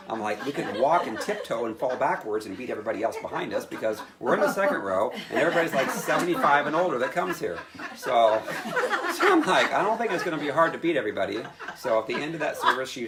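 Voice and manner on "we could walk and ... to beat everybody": laughing. Retold or imitated. Mocking the run to front.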